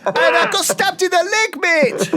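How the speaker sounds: loud, very high put-on accent, laughing; Gaza. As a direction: belt out a silly unplaceable accent through laughter. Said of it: "I don't know what accent this is".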